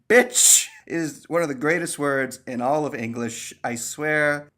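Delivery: usual speaking voice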